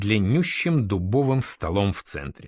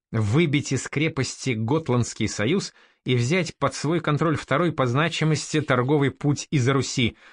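plain reading voice

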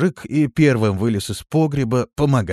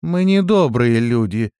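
slow, louder grumble; displeased; 3 semitones higher, 6 dB louder than usual. A narrator's reading. Лирея answering the cellar voice, mildly displeased. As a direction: correct them with mild displeasure. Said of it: "недовольно ответила Лирея".

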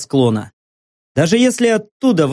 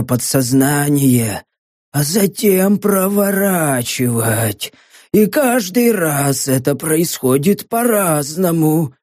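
a dying man raving to someone unseen; delirious muttering; slower than usual. Acted. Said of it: "в говорливом бреду".

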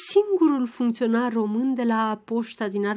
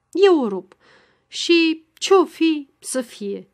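louder and higher, slow, ending in a drop; resolute cry; 5 semitones higher, 5 dB louder, slower than usual. A narrator's reading. Ghighi deciding to tear open the telegram, crying out. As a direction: blurt out the resolve slowly, then let it fall. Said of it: "strigă în cele din urmă Ghighi".

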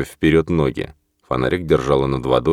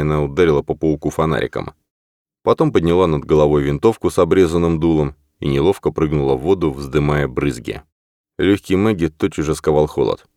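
ordinary delivery, nothing marked

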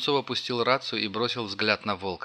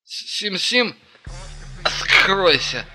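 put-on high, loud, slow drunken slur; drunk slurring; 9 semitones higher, 8 dB louder, much slower than usual. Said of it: "подражая пьяному заплетающимся языком".

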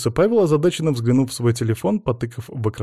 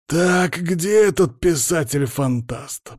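slow, higher-pitched angry growl; 6 semitones higher, much slower than usual. A narrator's reading. Boss storms in hunting the culprit, growling. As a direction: stretch it out as an irate boss's growl. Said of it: "прорычал наш босс".

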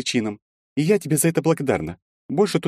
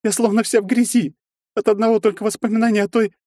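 high, louder, weeping plea; tearful pleading; 4 dB louder than usual. A narrator's reading. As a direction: beg him to leave through tears. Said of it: "и расплакалась".

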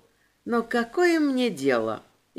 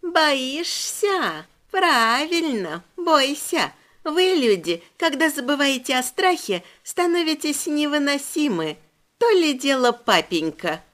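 put-on creepy demon girl; 4 semitones higher than usual. A narrator's reading. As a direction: savor the words like a hungry child demon. Demon taunts frightened humans.

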